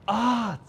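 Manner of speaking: high groaning shout at a miss; loud disappointed yell; frustrated shout. Real, not acted. Near miss on cross.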